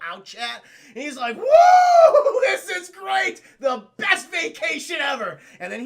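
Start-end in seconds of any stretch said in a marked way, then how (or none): 1.4-5.4 huge high whooping Predator cheer, slowed; gleeful shout. Retold or imitated. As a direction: yell it like the best holiday ever.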